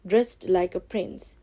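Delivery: plain reading voice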